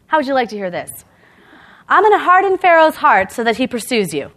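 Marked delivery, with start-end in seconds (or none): 1.9-4.3 lightly voiced God, louder and higher; a touch of divine proclamation; God speaking to Moses. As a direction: announce it loudly, as God would. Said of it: "this is what God tells Moses".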